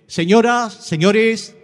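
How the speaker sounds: loud announcer call to the crowd. Acted. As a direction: call out to the whole square. Presenter calls out to crowd.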